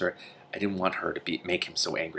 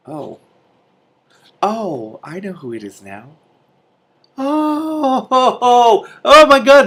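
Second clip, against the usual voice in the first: high, loud thrilled squeals; overjoyed shrieks of recognition; excited squeal; 12 dB louder, much slower than usual. Real, not acted. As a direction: burst out in real delight. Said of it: "this is exciting".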